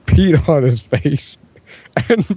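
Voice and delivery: plain reading voice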